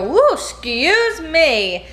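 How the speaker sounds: drawn-out, high whooping excuse me; big showy excuse me. Shown as a demonstration. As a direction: make a grand show of the apology. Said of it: "if you let out a huge giant burp, you go".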